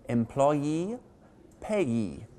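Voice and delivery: "Employee" and "payee", each stressed on the final EE; stress on final syllable. Shown as a demonstration. Teacher demonstrating suffix stress, "Employee" and "payee" stressed on the last syllable. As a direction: put the weight on the endings of "Employee" and "payee". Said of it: "which you generally stress".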